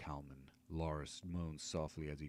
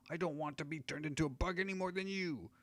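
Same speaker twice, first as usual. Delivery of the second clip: high halfling voice; 12 semitones higher than usual. Acted. Halfling voice continues.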